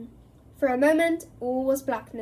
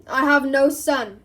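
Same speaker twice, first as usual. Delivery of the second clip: very loud raging denial; furious bellow; 2 semitones higher, 10 dB louder than usual. Acted. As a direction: roar it to the whole room. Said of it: "bellowed Mister Crouch".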